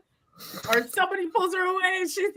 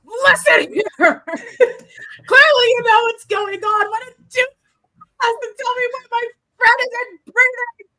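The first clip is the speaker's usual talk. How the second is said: higher and louder, shaking him hard; furious shout; 6 semitones higher, 5 dB louder than usual. Acted. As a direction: yell into his face while you shake him. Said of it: "she's just like".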